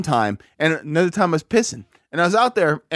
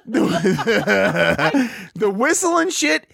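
high, slow words through laughter; laughing; 4 semitones higher, much slower than usual. Real, not acted. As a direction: laugh while getting it out. Repeats the phrase while laughing.

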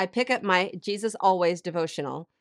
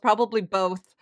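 normal talking voice

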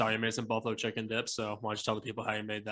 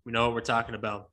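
usual speaking voice

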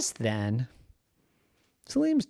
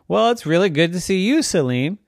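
a lightly raised, brisk talking-cat voice; 8 semitones higher, 10 dB louder than usual. Acted. Cat Ted greets his boy Salim, lightly voiced friendly cat. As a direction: play the cat, bright and quick.

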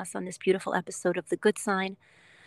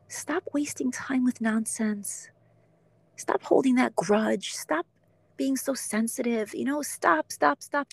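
higher-pitched, insistent acted self-talk; urgent self-admonition; 5 semitones higher, slower than usual. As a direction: urge yourself firmly, pressing each stop. Acted urging self-talk after hearing story.

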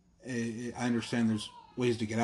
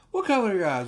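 higher, louder imitation of a probing mom; pry like a suspicious mother, higher and louder; questioning mom; 9 semitones higher, 5 dB louder, faster than usual. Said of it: "She's like".

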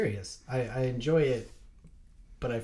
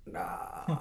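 dismissive; 6 semitones higher, 7 dB quieter than usual. Retold or imitated. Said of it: "and I went".

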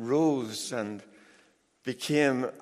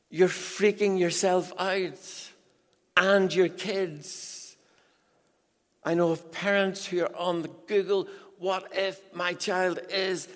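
passionate rant; 6 semitones higher than usual. Real, not acted. Preacher ranting at parents about Googling. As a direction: rant at the congregation.

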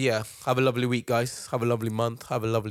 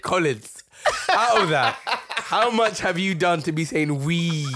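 laughing retold question; 6 semitones higher, 4 dB louder, slower than usual. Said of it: "So I said".

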